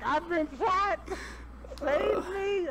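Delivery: very high whiny mock plea. Imitating Kay going down, a high whiny plea. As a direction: whine it like a helpless teammate. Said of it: "Kay's like".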